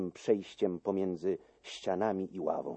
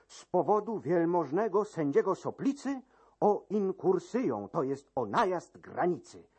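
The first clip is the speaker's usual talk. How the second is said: high, formal raised announcement; court usher's proclamation; 7 semitones higher than usual. Acted. Usher announcing a formal inquiry, high pompous proclamation. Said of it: "zawołał trzykroć".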